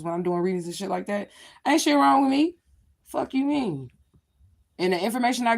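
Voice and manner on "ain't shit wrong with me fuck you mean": loud, high, defiant retort; defiant outburst. Real, not acted. A woman snaps back loud at people who call her damaged. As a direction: fire back at the doubters.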